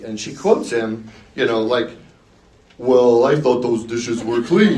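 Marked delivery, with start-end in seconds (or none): 2.8-4.8 dopey husband voice, laughing through it; oaf voice. Retold or imitated. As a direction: lightly play a dim-witted husband. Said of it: "quote your husband with an oaf voice".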